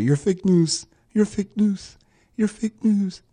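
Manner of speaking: hammed-up Trump insult; imitating the president scolding a reporter; mocking Trump. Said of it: "tells the C N N reporter".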